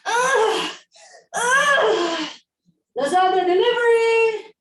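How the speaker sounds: high panicked shout; calling out. Acted. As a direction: yell out in a panic, high-pitched. Calling out from toilet.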